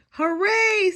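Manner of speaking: high, loud cheer; hooray. Acted. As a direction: cheer with delight. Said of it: "said Detective Dinosaur".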